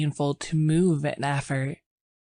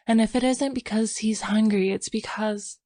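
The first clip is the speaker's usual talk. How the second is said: nervous, higher voice trailing off; faltering; 6 semitones higher than usual. Acted. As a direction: let the fear cut the line short. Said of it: "Her voice faltered".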